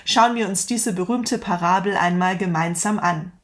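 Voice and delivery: ordinary delivery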